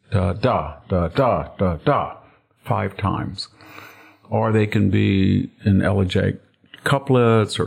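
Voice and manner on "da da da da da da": "da, da" chanted as light-heavy beats; iambic da-da beat. Shown as a demonstration. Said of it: "these are beats".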